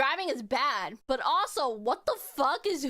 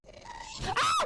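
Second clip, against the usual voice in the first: startled scream. Real, not acted. Mob spawns right behind her.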